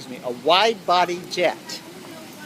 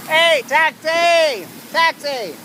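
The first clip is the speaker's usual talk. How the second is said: loud high call for a cab; hailing a taxi; 10 semitones higher, 3 dB louder, slower than usual. Pretend. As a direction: yell out to flag down a taxi. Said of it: "trying to get your goddamn attention".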